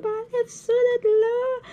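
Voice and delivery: high, slow wailing cry to get it off; crying plea. Retold or imitated. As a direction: whine it out in tears, high and drawn out. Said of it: "hey, je pleurais". (supplication en pleurs, aiguë et traînante)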